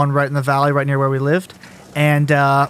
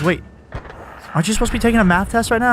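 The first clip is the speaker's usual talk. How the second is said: higher, fast puzzled question; puzzled teacher; 6 semitones higher, faster than usual. Retold or imitated. Teacher on phone to student, quick puzzled question. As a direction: rattle it off, half surprised. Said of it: "And he goes".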